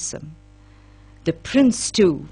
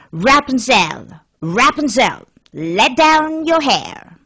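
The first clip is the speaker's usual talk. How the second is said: slow, drawn-out call, rising higher on the last words; witch calling; 4 semitones higher, 4 dB louder, slower than usual. A narrator's reading. Witch calls up the tower to Rapunzel, slow drawn-out call. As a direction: call up to the tower like an old witch, stretching each word.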